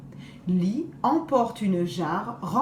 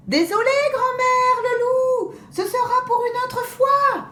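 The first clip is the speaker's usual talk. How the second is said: high, louder singsong teasing; sing-song taunt; 13 semitones higher, 4 dB louder than usual. Acted. The girls taunt the wolf as they let go, sing-song. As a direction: chant the goodbye mockingly.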